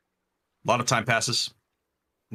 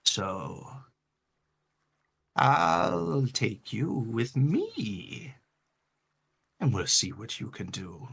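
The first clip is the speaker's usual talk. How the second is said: a tech-savvy storm giant murmuring as he decides; whispery giant; 2 semitones higher, 5 dB quieter than usual. Acted. Storm giant NPC speaks.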